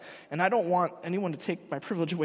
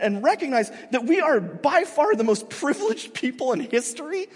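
ordinary talk, nothing marked

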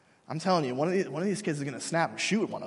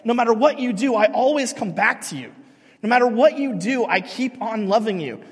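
impassioned God voice; 4 semitones higher, 6 dB louder than usual. Retold or imitated. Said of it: "Hosea is like God speaking".

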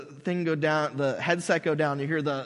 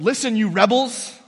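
louder, higher angry rebuke of the rebels; angry Moses; 5 semitones higher, 7 dB louder than usual. Retold or imitated. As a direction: snap at the crowd like a fed-up leader. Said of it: "He's mad".

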